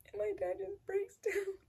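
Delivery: high, tear-choked voice; breaking down crying. Real, not acted. Telling of dad's news that mom is gone, sobbing. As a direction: sob slowly through the words, barely getting them out.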